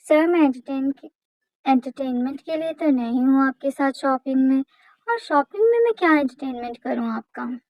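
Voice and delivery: plain reading voice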